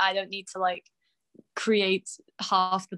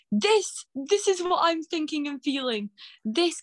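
excited; 8 semitones higher than usual. Retold or imitated. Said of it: "send them to my friends going".

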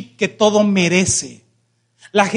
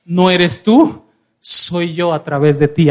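low, slow, weighty assurance; assuring declaration; 4 semitones lower, 3 dB louder than usual. Retold or imitated. Preacher voices Jesus assuring a humble servant, low and deliberate. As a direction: lay it down slowly and firmly. Voices Jesus, first take before shouted repeat.

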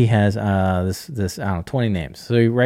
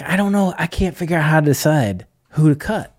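whiny client; 6 semitones higher than usual. Retold or imitated. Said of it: "'cause you're like".

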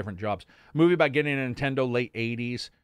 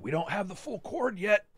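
imitating dad; 8 semitones higher than usual. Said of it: "that fucker would still be like".

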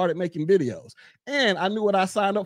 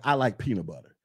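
calm answer; 10 semitones lower, 3 dB quieter than usual. Retold or imitated. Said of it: "Lil Big Mad could be like".

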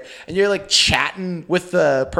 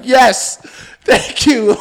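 louder, higher mock gracious thanks; mock game-show guest; 6 semitones higher, 7 dB louder, much slower than usual. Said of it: "saluting people like you're on The Price is Right".

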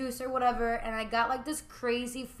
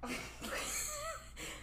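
high squealing laugh that breaks into a cry; embarrassed laugh; 12 dB quieter than usual. Real, not acted. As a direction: cackle helplessly at yourself. Embarrassed laugh after joke.

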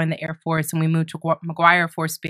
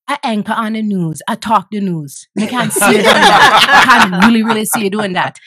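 put-on higher-pitched Jamaican accent, breaking into laughter; Jamaican grandmother; 3 semitones higher, 7 dB louder than usual. Grandmother telling grandchild she could anchor news, Jamaican accent. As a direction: imitate a Jamaican grandmother urging you on. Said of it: "she had came from Jamaica".

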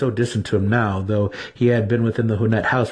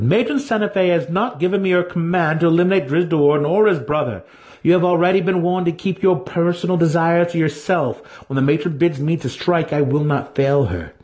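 higher, cold drow enforcer, stern; Massage's voice; 7 semitones higher than usual. A narrator's reading. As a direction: warn him firmly from a raised pitch. Narrator voices Masoj.

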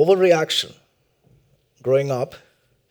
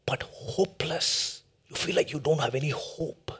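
whisper; 8 dB quieter than usual. Acted. Sermon dramatic drop.

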